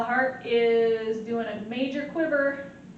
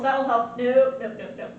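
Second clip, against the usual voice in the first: ordinary delivery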